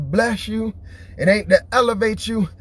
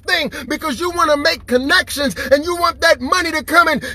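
preaching; 6 semitones higher than usual. Acted. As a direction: preach it hard to the crowd. Preaching at full force.

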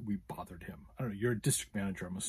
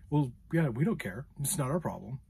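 imitating an uncaring manager, pitched up a bit; dismissive shrug of a voice, exaggerated in retelling; mock matter-of-fact; 5 semitones higher, 5 dB louder than usual. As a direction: brush them off, mockingly. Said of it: "It was this matter of fact".

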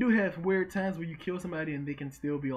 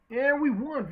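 loud, high victory cheer; excited cheer; 8 semitones higher, 7 dB louder, slower than usual. Real, not acted. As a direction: celebrate the win out loud. Match victory.

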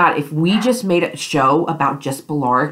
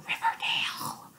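breathy whispered repeat of Riverdale; whispered echo; 15 dB quieter than usual. Acted. Vlogger whispering the show's name to viewers.

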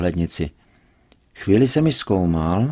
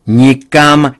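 loud, higher refusal; forbidding shout; 5 semitones higher, 10 dB louder than usual. A narrator's reading. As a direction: shout her plan down. Father forbids India trip.

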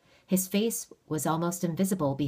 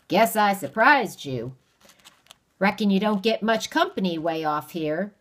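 folksy stranger; 4 semitones higher, 7 dB louder than usual. Acted. Stranger voice held.

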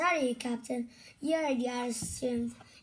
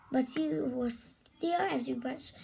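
usual speaking voice

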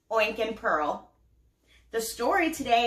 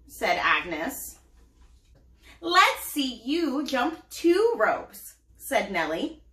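put-on lively storybook reading for kids; story narration; 3 semitones lower, slower than usual. A narrator's reading. Mom reading a picture book to her child, animated. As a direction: read it out like bedtime to little ones.

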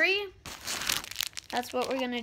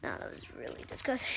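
whispering; 8 semitones lower, 7 dB quieter, much faster than usual. Real, not acted. Admits candy tastes bad.

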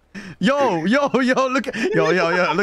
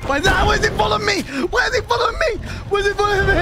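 panicking gamer; 3 dB louder, much faster than usual. Real, not acted. Chased by Bigfoot in game.

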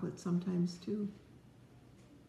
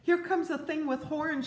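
mild mock shout; 9 semitones higher than usual. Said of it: "shouted a rabbit".

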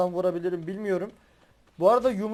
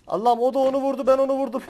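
louder, higher frantic gamer outburst; excited; 6 semitones higher, 5 dB louder than usual. Real, not acted. Streamer to viewers mid-fight, excited outburst. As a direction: blurt it out in the heat of a gunfight. Frantic firefight, shooting at enemy.

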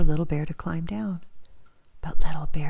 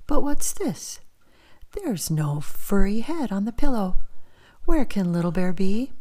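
higher, playful pretend surprise; mock puzzled; 3 semitones higher, 4 dB louder than usual. Father bear pretending to search, playfully surprised. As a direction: act puzzled for the hiding cub.

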